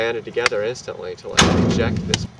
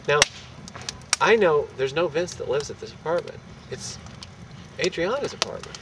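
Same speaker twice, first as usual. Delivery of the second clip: normal talking voice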